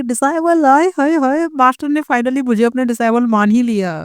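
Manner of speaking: high, giddy squeals of delight; overjoyed squeal. Acted. Junior disciple thrilled her master finally accepts her, giddy high squeal. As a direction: gush like a fangirl whose dream came true.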